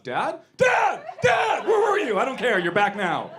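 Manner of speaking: high, loud yelps of excitement; hammed-up dog greeting its owner; overjoyed dog voice. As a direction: shout it high, bursting with joy, then ease slightly. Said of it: "this is my dog".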